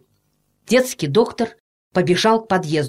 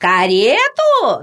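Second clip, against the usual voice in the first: drawn-out, indignant higher-pitched query; shouted question; 4 semitones higher, 4 dB louder, much slower than usual. A narrator's reading. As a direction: call it down in disbelief, stretching it. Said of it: "закричала Анна Петровна". (протяжный возмущённый переспрос)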